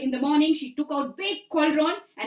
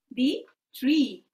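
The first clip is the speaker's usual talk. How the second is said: plain reading voice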